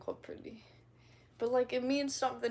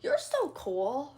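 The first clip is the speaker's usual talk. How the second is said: put-on admiring kid; 3 semitones higher, 4 dB louder, much slower than usual. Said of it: "when kids are like".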